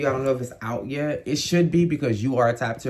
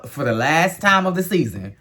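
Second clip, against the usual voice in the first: ordinary talk, nothing marked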